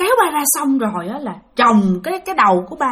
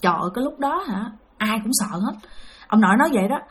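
plain reading voice

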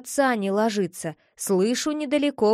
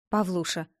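plain reading voice

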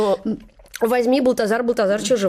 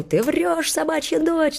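retold angry outburst; 9 semitones higher, slower than usual. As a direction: imitate an indignant man's insult in a raised pitch. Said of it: "как закричит на меня".

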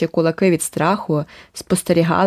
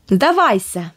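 shrill shout; 2 semitones higher, slower than usual. Acted. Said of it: "закричав інший верескливий голос".

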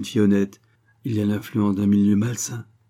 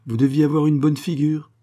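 higher, louder amused outburst; exclaiming; 6 semitones higher, 5 dB louder than usual. A narrator's reading. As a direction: cry it out, teasing and delighted. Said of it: "s'écria-t-elle". (Angèle s'écrie, amusée et plus fort)

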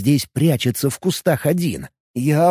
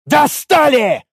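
high, forceful cry; exasperated shout; 12 semitones higher, 4 dB louder, much slower than usual. A narrator's reading. Warrior reaching for his mace, exasperated shout. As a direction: shout it as one fed up. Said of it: "не прекращая кричать".

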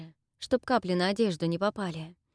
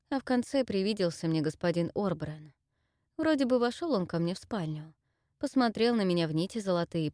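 ordinary delivery, nothing marked

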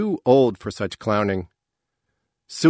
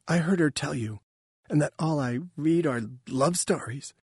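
higher, lightly voiced mother; plaintive and a little halting; hurt mother; 5 semitones higher, 4 dB quieter than usual. A narrator's reading. Mother repeating what was said of her, higher plaintive voice. Mother reports being belittled.

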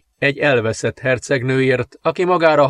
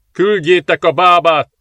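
loud, higher urgent call across the room; 6 semitones higher, 6 dB louder than usual. A narrator's reading. Priest shouting to the servants to fetch the midwife, loud urgent call. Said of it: "kiáltott ki a szolgáknak".

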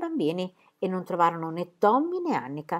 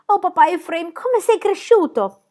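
put-on high, loud child's exclamation; thrilled, high-pitched welcome; overjoyed greeting; 11 semitones higher, 5 dB louder, slower than usual. A narrator's reading. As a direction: gush with delight, stretching the last words. Pippi greets her father joyfully.

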